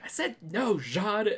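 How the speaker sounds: high-pitched retold scolding, little extra volume; mock yelling. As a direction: imitate a telling-off, keep it light. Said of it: "She's yelling at me".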